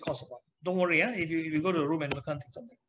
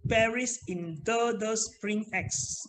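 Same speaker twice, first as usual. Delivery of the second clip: slow, word by word: Paris in the, the spring X; read slowly; 3 semitones higher, much slower than usual. Shown as a demonstration. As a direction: drag out every word of the phrase. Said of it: "This time read slowly".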